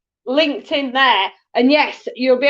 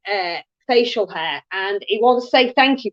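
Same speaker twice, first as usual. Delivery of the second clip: ordinary talk, nothing marked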